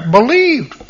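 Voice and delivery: "Believed" lifted high, standing alone; a single emphatic "Believed"; stress on Believed. Shown as a demonstration. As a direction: say "Believed" like block capitals. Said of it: "put that in block capital letters".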